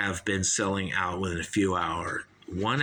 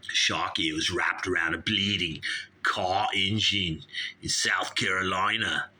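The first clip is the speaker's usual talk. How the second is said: put-on Aussie snake handler; Australian accent. Snake handler tells radio host about the boa, Australian accent. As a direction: lean into comic Australian vowels. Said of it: "Oh, I like Australian".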